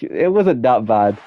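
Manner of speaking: ordinary talk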